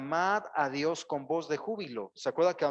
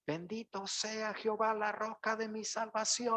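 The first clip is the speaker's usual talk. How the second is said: high, joyful proclamation of praise; joyful acclamation; 7 semitones higher than usual. Shown as a demonstration. Preacher showing true acclamation, in a high, joyful proclamation. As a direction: lift the words up in praise. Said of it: "Aclamar a Dios con voz de júbilo es decir".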